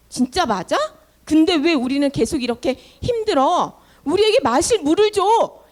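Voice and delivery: indignant complaining, building to a loud demand; a crowd of thirsty people griping, then yelling for water; angry crowd complaint. Acted. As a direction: complain bitterly at Moses.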